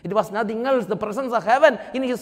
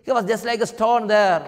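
normal talking voice

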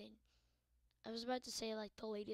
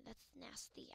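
muttered-under-breath whisper; 12 dB quieter than usual. Acted. Gamer whispering a disgusted aside. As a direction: say it as a quiet aside.

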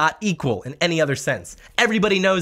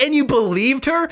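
mock retort; 10 semitones higher, 5 dB louder than usual. Mock retort in quoted exchange.

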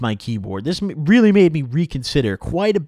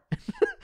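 quick high chuckle; a little laugh at himself; real amused chuckle; short laugh; 6 dB quieter than usual. Real, not acted. Laughing at own story.

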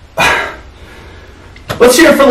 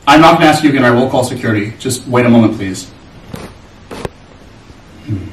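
calm turning angry; 8 semitones lower than usual. Acted. Waiter losing patience with customer.

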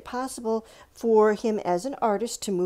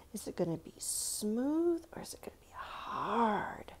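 whisper; 7 dB quieter than usual. Real, not acted. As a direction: whisper it like a secret. Teacher whispers question to children.

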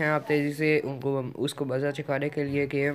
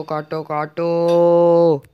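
frantic yell; 3 semitones higher, 11 dB louder, much slower than usual. Real, not acted. Frantic mining under attack.

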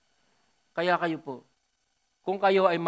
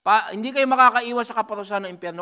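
fiery warning; 7 semitones higher, 10 dB louder than usual. Acted. Preaching at full force.

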